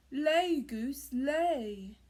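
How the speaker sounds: loud barked order to the goose; giant's loud command. Acted. Giant ordering his golden goose, loud cry. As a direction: call it out so the goose obeys. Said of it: "he cried".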